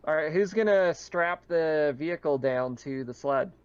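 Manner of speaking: ordinary delivery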